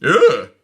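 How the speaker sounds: high disgusted ugh. A narrator's reading. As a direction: recoil with a squeal. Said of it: "wrinkling her nose".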